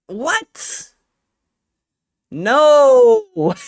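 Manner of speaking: a sudden high, loud cry of disbelief; real shock, voice jumping up and shouting; shocked outburst. Real, not acted. Streamer sees the packs sold out, loud shocked cry.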